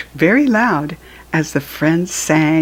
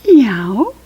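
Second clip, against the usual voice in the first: cat meow; 6 semitones higher, 4 dB louder than usual. Pretend. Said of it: "this is the cat".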